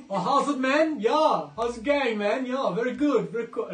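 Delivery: loud, high-pitched hammed-up South African; South African accent. Quiz host doing an accent on request, in South African. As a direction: greet a mate in a broad South African accent. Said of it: "South African".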